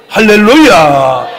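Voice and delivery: very loud, long hallelujah; preacher's shout. Real, not acted. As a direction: belt it out slowly to the congregation. Preacher calls out to congregation. (힘껏 길게 외치는 할렐루야)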